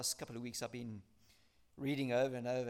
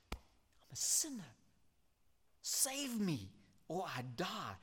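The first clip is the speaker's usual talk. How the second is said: pleading tax collector; 4 semitones higher, much slower than usual. Acted. Tax collector beating his chest.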